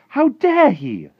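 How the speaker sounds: very high, slow, loud indignation; drawn-out girlish outrage; outraged cry. A narrator's reading. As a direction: cry it out appalled. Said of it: "Sophie cried".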